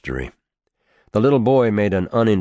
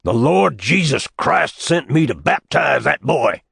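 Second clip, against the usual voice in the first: fervent shout, louder and higher; 8 semitones higher, 4 dB louder than usual. Acted. Old preacher at the schoolteacher's door, fervent shout. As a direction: proclaim it at the door like a prophet. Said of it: "he shouted".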